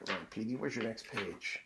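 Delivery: soft off-story murmur; quiet aside. Real, not acted. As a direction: coax the book along under your breath. Reader mutters while finding the page.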